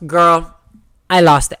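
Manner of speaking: loud, emphatic exclamation. Real, not acted. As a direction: belt it out, worked up. Excited retelling of crying.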